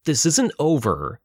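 slow, high angry warning; acted yell without much added volume; yelled threat. A narrator's reading. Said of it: "he yelled".

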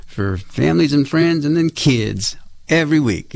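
ordinary talk, nothing marked

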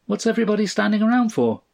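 put-on high, quick child's question; puzzled little boy. A narrator's reading. Son finds family standing around, puzzled and high-voiced. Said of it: "He stopped".

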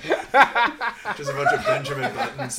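slow, high, loud laughing. Real, not acted. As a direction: drag it out while cracking up. Laughing at joke.

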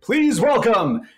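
slow, high, shouted welcome; big shout. Acted. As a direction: bellow the name out. Climax of the big introduction.

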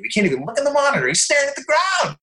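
high-pitched, racing mock panic; panicked inner voice. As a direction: act out a mounting freak-out, pitch soaring. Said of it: "all my brain was doing".

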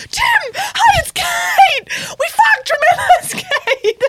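high, loud laughing shout. Retold or imitated. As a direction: yell it across the street, laughing. Shouting joke greeting at Tim.

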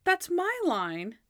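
high, slow indignant protest; mock-offended claim of ownership; Monk. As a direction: stretch it out, affronted. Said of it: "And then Monk's like".

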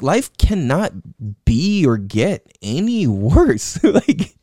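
ordinary delivery

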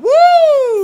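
high, loud gleeful whoop; whooping "woo". Acted. Co-host acts out the hero riding past, exuberant whoop. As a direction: let out a long, high joyriding whoop. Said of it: "just riding by the tank, going".

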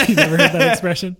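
loud, high laughing question. Real, not acted. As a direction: ask through laughter. Laughing while teasing.

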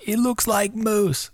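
high, slow, amazed squeal; excited squeal. Retold or imitated. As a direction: gasp it out in surprise. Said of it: "the girls were freaking out".

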